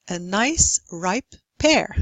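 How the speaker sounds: "nice", "ripe", "pear" each a slow separate beat; slow stressed beats. Shown as a demonstration. Lecturer gives rhythm example, "nice ripe pear" slowly and evenly stressed. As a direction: draw out "nice ripe pear" in even time. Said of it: "some more examples".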